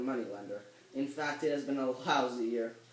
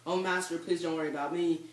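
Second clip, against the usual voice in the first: frantic pleading; 4 semitones higher, 6 dB louder than usual. Acted. Servant urges master to flee.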